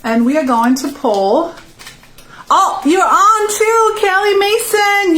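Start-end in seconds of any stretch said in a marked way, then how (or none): 2.5-5.2 higher, slower, delighted discovery of the winner; excited. Real, not acted. Host announcing the giveaway winner to viewers, excited. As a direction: announce the lucky name like a happy surprise.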